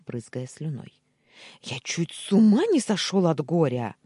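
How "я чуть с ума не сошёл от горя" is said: loud, protesting wail; indignant outcry. A narrator's reading. Uncle claims grief to nephew, indignant outcry. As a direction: protest loudly as if wronged.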